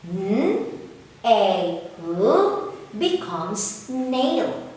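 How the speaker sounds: slow blended phonics, each sound held; blending sounds. Shown as a demonstration. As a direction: sound out N A L slowly, then say "nail". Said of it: "Now let's combine all the sounds".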